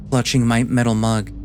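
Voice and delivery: usual speaking voice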